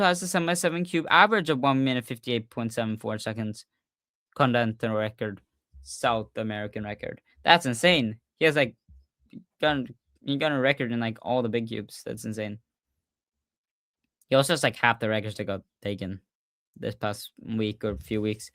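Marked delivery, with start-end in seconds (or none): none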